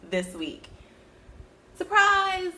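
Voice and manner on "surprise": excited. Real, not acted. Announcing extra projects.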